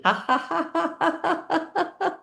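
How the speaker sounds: high rising ha ha laughter; real giggly laughter climbing up; laughing demo. Shown as a demonstration. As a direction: laugh while making tea. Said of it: "and you're laughing".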